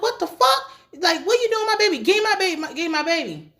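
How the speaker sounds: high-pitched frantic demand; acted outrage. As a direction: demand the baby back, pitch way up. Acts out mother confronting couple.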